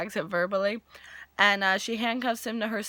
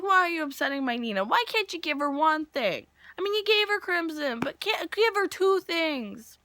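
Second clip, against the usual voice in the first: mock indignant pleading; 6 semitones higher than usual. Mock pleading on the character's behalf.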